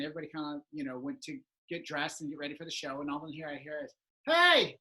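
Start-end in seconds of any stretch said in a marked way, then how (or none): 4.3-4.8 higher, louder call from behind a door; hey hey, mimic a trapped friend shouting for anyone; mock calling for help. Friend locked in bathroom calling.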